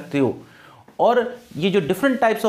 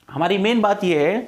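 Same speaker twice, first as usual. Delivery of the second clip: usual speaking voice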